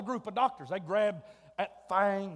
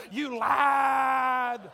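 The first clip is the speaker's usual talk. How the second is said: playful shout; 2 semitones higher, 5 dB louder than usual. Acted. Teasing congregation.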